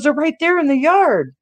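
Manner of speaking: high-pitched mom pointing out the surprise; excited mother. Retold or imitated. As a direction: imitate a mother proudly revealing a gift. Mother revealing the surprise, retold.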